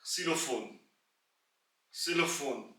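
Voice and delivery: सिलोफोन with a light k before the s; soft German demo. Shown as a demonstration. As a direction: quietly say सिलोफोन. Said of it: "थोड़ी सी हल्की सी के की आवाज". (सिलोफोन, हल्के क के साथ)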